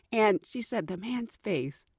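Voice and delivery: ordinary talk, nothing marked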